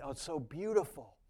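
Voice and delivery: usual speaking voice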